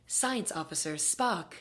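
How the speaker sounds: lightly voiced computer announcement; ship computer. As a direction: announce it slowly as the ship's computer. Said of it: "as the ship computer".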